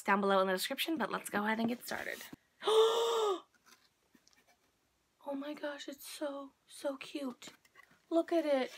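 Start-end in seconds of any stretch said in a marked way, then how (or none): 2.6-3.4 sharp high gasp of delight; excited gasp. Real, not acted. Excited unboxing reveal. 5.3-7.5 soft unvoiced whisper of delight; awed whisper. Real, not acted. Awed over cute box contents.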